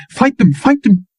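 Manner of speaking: high, cracking repeated pleas; fading desperate begging; high voice breaking, trailing off. A narrator's reading. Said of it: "Her voice had a break in it".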